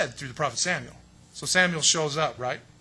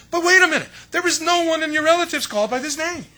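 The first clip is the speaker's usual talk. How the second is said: startled objection; 10 semitones higher, 7 dB louder than usual. Retold or imitated. Neighbors object to the name.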